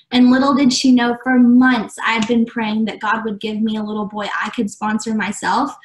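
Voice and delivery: usual speaking voice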